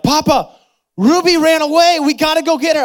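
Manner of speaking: high, loud imitation of panicked children; upset kids. Children begging their papa to chase the runaway dog, high frantic voice.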